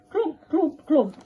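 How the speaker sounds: high stomping clump sounds; clumping stick. Acted. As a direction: thump it out like a heavy stick.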